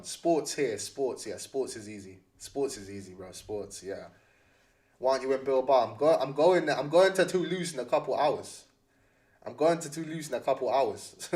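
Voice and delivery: ordinary talk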